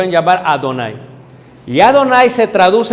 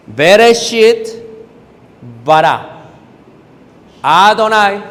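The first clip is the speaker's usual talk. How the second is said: slow, loud Hebrew recitation, word by word; recitación en hebreo; 2 semitones higher, 4 dB louder, slower than usual. Shown as a demonstration. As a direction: declaim each word, stretched, with pauses between. Recites Genesis opening in Hebrew. (recitado lento y solemne, palabra por palabra)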